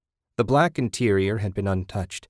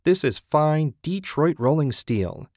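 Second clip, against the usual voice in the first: higher, slow, proud boast; proud exclaiming; 7 semitones higher than usual. A narrator's reading. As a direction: savour each word like a salesman. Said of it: "Ted exclaimed".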